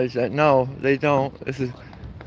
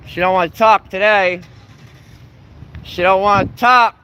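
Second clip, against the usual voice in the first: taunting call; 4 semitones higher, 3 dB louder than usual. Real, not acted. Heckling woman who won't talk.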